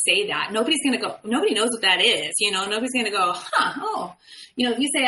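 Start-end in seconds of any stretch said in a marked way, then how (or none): 3.3-4.2 mock puzzled reaction. Mocking a blank listener reaction.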